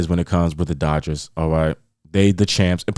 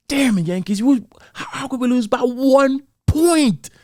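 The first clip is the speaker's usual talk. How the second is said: exasperated disbelief. Retold or imitated. Retold disbelief at the losing score.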